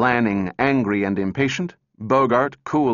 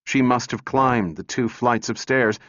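ordinary delivery, nothing marked

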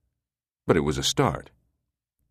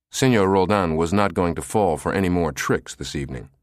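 ordinary delivery, nothing marked

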